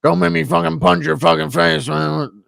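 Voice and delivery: garbled, mumbling put-on fighter voice; hammed-up impression, words mashed together; Stipe Miocic. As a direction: slur it into a threatening mock growl. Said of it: "Miocic telling his kids he's Father Christmas".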